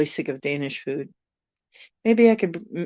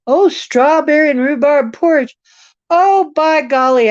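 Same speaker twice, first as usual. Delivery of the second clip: excited; 11 semitones higher, 10 dB louder than usual. Real, not acted. Recognizes the dish excitedly.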